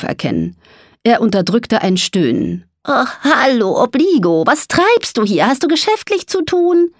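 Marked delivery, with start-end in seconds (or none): 2.8-6.9 high-pitched, forced-polite greeting; uneasy mole. A narrator's reading. Mole greets dragon Obligo after stifling a groan, uneasily polite.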